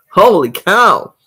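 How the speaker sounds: ordinary delivery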